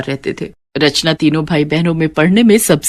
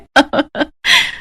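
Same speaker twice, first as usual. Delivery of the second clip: laugh; 9 semitones higher than usual. Acted. Friend laughs at her own joke.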